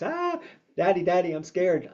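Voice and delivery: put-on high frightened little child's cry; scared child. Toddler runs to daddy after the dog barks, high and frightened.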